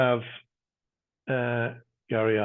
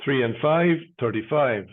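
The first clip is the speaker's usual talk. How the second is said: steady sing-song number calling; bingo caller. Acted. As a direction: call each number out, then repeat it.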